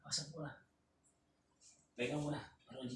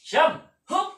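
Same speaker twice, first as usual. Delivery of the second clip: high, loud count call; shouted count; 13 semitones higher, 13 dB louder than usual. Real, not acted. Counting kuya's reps, loud high shout. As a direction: belt out the number.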